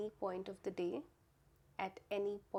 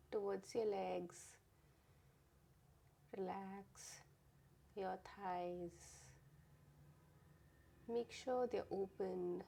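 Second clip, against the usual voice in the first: guided meditation. Real, not acted. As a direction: gently guide them to relax, part by part.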